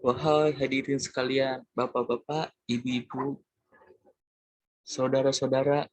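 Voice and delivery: a lightly voiced preacher hailing the crowd; a hint of a sermon opening; preacher's greeting. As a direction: call out to the congregation like a preacher, mildly. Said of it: "si penceramah itu bilang".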